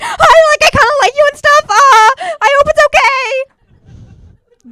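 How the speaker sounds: put-on high, loud, flustered rush of a nervous kid; nervous squeaky confession. Comic to her crush, confessing nervously in a high loud voice. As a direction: blurt it out, panicking, then stall.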